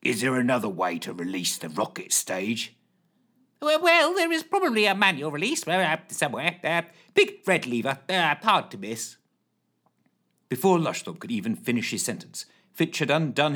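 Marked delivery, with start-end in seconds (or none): 0.0-2.8 low gruff voice; gruff Fitch. Acted. 3.6-9.2 high hesitant flustered voice; flustered Lushthorpe. Acted.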